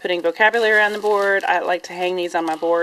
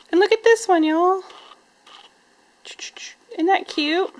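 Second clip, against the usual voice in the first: delighted; 12 semitones higher than usual. Real, not acted. To viewers, showing a cute pencil holder, delighted high squeal. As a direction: gush over the cute find.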